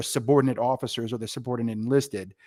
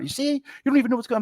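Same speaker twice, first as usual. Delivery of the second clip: fast, high, scornful boss voice; mock boss berating; 11 semitones higher, much faster than usual. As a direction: snap it off like a boss.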